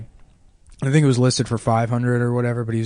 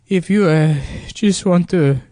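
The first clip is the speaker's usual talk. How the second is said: put-on higher, halting, pleading Mexican accent; mock tearing up; 6 semitones higher than usual. Landlord begging renter to come back, mock-tearful Mexican accent. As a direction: imitate a landlord close to tears, pausing between phrases. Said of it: "he's, like, tearing up".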